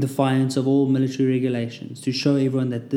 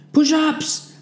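loud, high barked order; shouted command; 5 dB louder than usual. A narrator's reading. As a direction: yell it at the troops. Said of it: "the finishing command".

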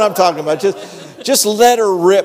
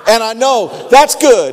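louder exclamation through a laugh; laughing shout; 3 semitones higher, 4 dB louder than usual. Real, not acted. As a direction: burst out with joyful conviction.

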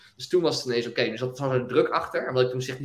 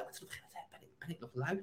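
hushed self-question; 3 semitones higher, 18 dB quieter, faster than usual. Retold or imitated. Asking himself if still lazy.

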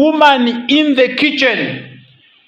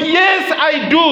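eager shouted yes; 7 semitones higher than usual. Retold or imitated. Groom answering loudly.

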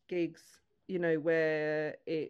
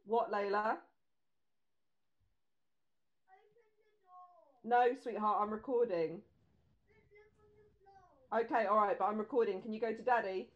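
higher-pitched, gentle parent voice; 6 semitones higher than usual. Real, not acted. Breaks off to talk to child.

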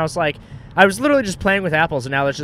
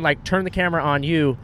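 ordinary talk, nothing marked

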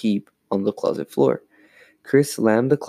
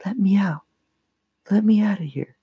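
small, high-pitched cry for help; tiny voice; 10 semitones higher than usual. A narrator's reading. Dummy calling from inside the closet, tiny muffled plea. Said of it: "she heard the tiny voice".

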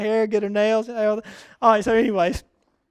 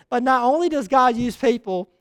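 normal talking voice

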